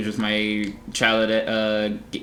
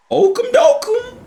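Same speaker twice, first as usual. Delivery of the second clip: tiny high cartoon squeak; squeaky silly voice; 6 dB louder, slower than usual. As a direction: chirp it like a cartoon.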